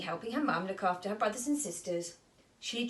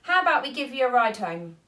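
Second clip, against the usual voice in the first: hearty offer; 3 semitones higher, 9 dB louder, faster than usual. Acted. Astronaut offers.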